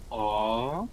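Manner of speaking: put-on cute anime girl aww; anime impression. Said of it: "That's my anime impression".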